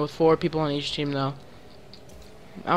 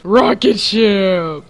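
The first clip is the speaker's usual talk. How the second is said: loud, slow, high holler; excited yell; 5 semitones higher, 9 dB louder, much slower than usual. Real, not acted. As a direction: stretch it out in a big shout. Calling out a flying shot.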